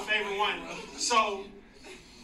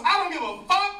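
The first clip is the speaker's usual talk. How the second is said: high, loud dismissive retort; preacher's mock outburst; 9 semitones higher, 7 dB louder than usual. Preacher answering the friend, mock angry outburst. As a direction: imitate a preacher blowing her off. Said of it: "He goes".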